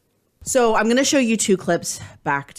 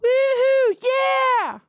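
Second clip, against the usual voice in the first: cheering; 3 dB louder, much slower than usual. Acted. Cheering for segment start.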